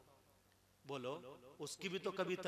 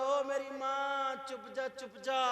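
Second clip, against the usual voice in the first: much higher and louder, slow drawn-out shout; mock shouted plea; 9 semitones higher, 7 dB louder, slower than usual. Husband shouting his plea at his wife, higher and louder. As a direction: yell ओ मेरी माँ at the wife. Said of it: "हाथ जोड़ के बोला".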